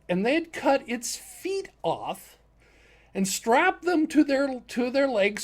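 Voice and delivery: ordinary delivery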